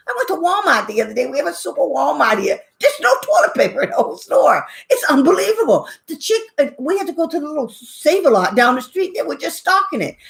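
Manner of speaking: ordinary talk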